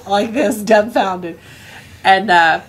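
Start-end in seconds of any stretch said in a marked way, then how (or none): none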